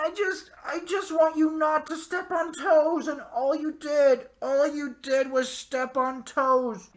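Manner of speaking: high-pitched, loud exasperated plea; exasperated rant. Real, not acted. Fan pleads with the show's makers, high exasperated rant. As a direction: strain it out high and loud, easing down towards the end.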